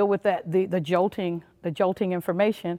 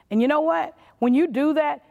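ordinary talk, nothing marked

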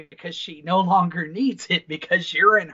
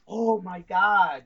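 slow, stunned "oh my God"; much slower than usual. Retold or imitated. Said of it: "I was like".

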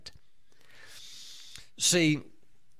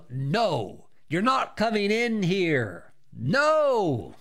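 slow, emphatic, pitched-up command; commanding refusal; 4 semitones higher, much slower than usual. Shown as a demonstration. Preacher showing how to resist the devil, commanding refusal. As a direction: draw it out and bar the way.

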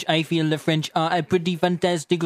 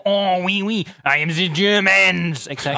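bad French accent; 3 semitones higher than usual. Mocking his own French accent for his co-host, French accent. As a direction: ham up a French accent that drifts. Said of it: "Your French accent just kind of veers off".

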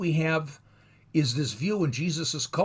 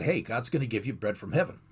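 quick Moses quote; 6 semitones lower, 4 dB quieter, faster than usual. Retold or imitated. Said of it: "Moses said".

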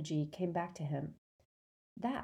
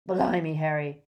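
panting; 5 dB louder than usual. A narrator's reading. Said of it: "panted Hagrid".

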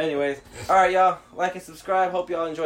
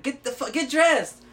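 high, raised-voice command; retold exasperated order; 12 semitones higher, 6 dB louder, slower than usual. Teller acts out ordering a naked drunk friend, exasperated.

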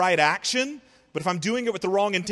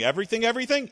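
rising disbelieving double take; mock doubtful echo; incredulous question; 6 semitones higher, much faster than usual. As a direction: ask it as if it can't be true. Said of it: "you might be asking".

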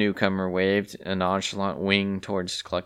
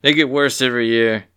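scornful mutter; 3 semitones higher, 8 dB louder than usual. A narrator's reading. Older student scorn.

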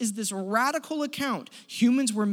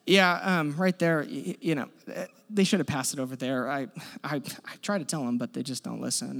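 mock muttering parent; 7 semitones lower than usual. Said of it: "he's whispering to the people around him".

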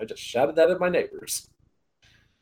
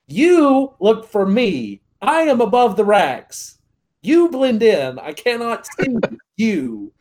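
louder, slow lecturing voice; slow, over-explaining; 8 semitones higher, 5 dB louder, much slower than usual. Retold or imitated. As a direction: spell it out like to a child. Lecturing wife slowly.

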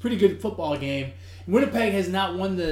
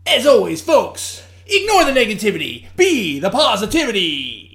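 loud, high outro call; announcer sign-off; 4 semitones higher, 9 dB louder than usual. Acted. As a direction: send the listeners off upbeat. Show sign-off.

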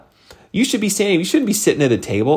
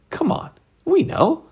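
mock know-it-all; 3 dB quieter than usual. Mocking know-it-all listeners.

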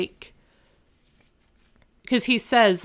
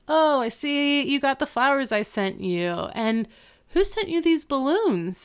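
high-pitched, singled-out curiosity; imitated chirpy questioning; mock friendly surprise; 7 semitones higher than usual. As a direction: play the visitor noticing gifts, voice lifted high. Retold visitor noticing flowers, balloons.

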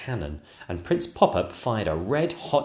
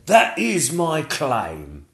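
very slow, louder dragon; dragon proud claim; 7 semitones higher, 5 dB louder, much slower than usual. Acted. Dragon slapping his chest to claim the reward, slow and proud. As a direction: plant each word boastfully. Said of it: "cried the dragon".

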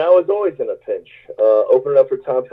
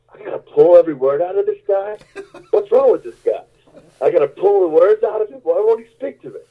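higher mock of a frustrated host; exasperated host; 7 semitones higher than usual. Musician imitating the host's frustration. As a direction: play the baffled host, exasperated. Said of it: "He's like".

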